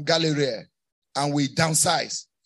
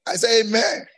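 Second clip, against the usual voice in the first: higher, pressing call for amen; insistent repeat; 5 semitones higher, 4 dB louder than usual. Real, not acted. Preacher demands a response from the congregation, insistent. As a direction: prod the crowd for a stronger response.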